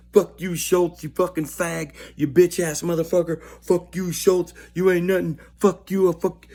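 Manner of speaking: high, loud mocking taunts shouted at the guard; jeering inmates. Inmates jeer at hated guard Schultz on his rounds, high loud yelling. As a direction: yell it up at the guard like a cellblock heckler.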